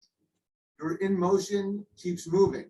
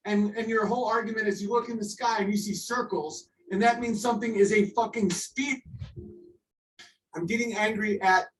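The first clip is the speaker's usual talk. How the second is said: ordinary talk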